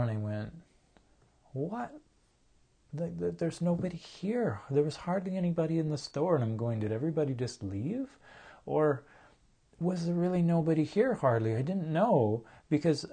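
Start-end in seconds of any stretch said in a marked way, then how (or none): 1.5-11.6 startled disbelief. Retold or imitated. Startled to find store empty.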